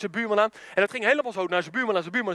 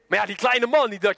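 ordinary talk, nothing marked

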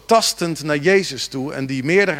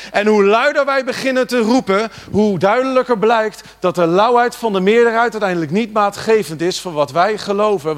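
louder, higher, driving the point home; full-force preaching; 6 semitones higher, 6 dB louder than usual. Acted. Preacher urging the congregation to cry out, full force. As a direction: preach it out to the church.

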